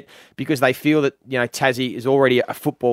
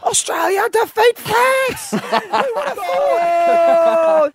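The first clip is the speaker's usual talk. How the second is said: high, slow soaring commentator call; soccer commentator; much slower than usual. Pretend. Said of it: "you can also commentate soccer".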